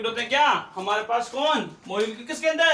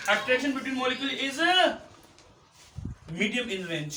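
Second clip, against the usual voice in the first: slow and higher, last word left hanging; slow dictation; 5 semitones higher, much slower than usual. Real, not acted. Teacher repeating a sentence for pupils to copy, slow dictation. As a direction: dictate it slowly, pitched up.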